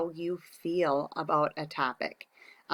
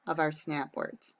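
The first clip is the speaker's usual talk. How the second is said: whisper; 2 semitones lower, 5 dB quieter than usual. Real, not acted. Teacher aside while writing.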